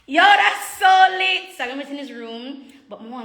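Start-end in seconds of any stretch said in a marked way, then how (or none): 0.1-1.6 excited. Real, not acted. Excited about the room.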